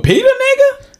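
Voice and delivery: high squeal through laughter; laughing teasing question to his cousin; laughing disbelief. Real, not acted. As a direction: crack up while asking.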